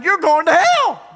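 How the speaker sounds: high, loud blunt declaration; shouted hell warning. Retold or imitated. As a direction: blurt it out at the door. Preacher blurts warning.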